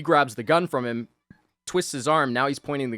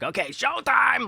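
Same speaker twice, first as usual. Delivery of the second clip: mock showtime; 12 semitones higher than usual.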